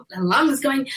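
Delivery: ordinary talk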